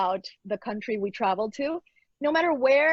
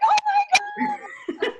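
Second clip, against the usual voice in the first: overjoyed mother planning a party; thrilled mom; 4 dB louder, slower than usual. Retold or imitated. Mom hearing her baby's first Spanish words, thrilled squeal. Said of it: "And I'm like".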